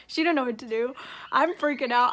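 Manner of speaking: normal talking voice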